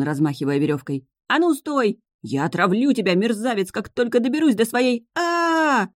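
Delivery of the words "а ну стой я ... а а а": высокий, громкий крик с угрозой; грозит вору, срываясь на вопль; гневный ор. A narrator's reading. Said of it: "заорал он". (гневный крик с угрозой)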